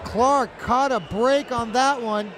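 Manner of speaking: ordinary delivery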